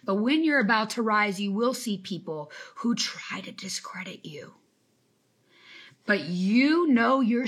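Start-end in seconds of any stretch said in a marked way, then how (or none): 1.4-4.5 hushed, unvoiced aside; whispered warning to the viewers. Real, not acted. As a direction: lean in and whisper it like a secret. Confiding warning to her audience.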